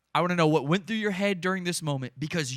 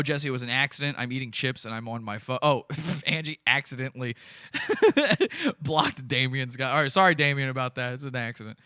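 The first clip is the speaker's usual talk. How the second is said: usual speaking voice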